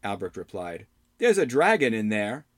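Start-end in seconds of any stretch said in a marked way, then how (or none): 1.2-2.5 put-on startled young hero. Albrecht speaks.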